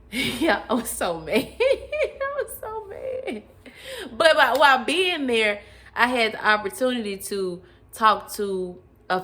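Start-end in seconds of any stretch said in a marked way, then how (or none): 1.6-3.4 high squeaky laugh-through repeat; giggling mock outrage. Real, not acted. Laughing repeat of own anger.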